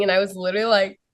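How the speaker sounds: ordinary talk, nothing marked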